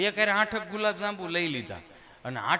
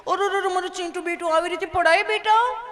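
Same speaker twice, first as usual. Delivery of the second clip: put-on high cooing baby talk to a fallen child; doting mother; 12 semitones higher, 5 dB louder than usual. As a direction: coo over the little one like a fussy mom. (લાડ કરતી મમ્મીનો ઊંચો લાડભર્યો અવાજ)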